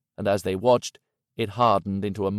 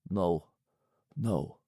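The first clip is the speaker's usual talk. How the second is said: softer, choked-off denial; low, strangled; 3 semitones lower, 6 dB quieter than usual. A narrator's reading. Yanchi after the call, softer and strangled. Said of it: "in a low, strangled voice".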